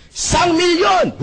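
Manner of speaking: slow, high booming announcement; hype shout. Retold or imitated. Seminar speaker shouting a big number to the crowd, booming. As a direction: bellow it like a stage host pumping up the room.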